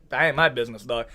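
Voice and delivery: low, quiet brush-off; hushed, staying-out-of-it reply; dismissive reply. Acted. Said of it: "I'm like".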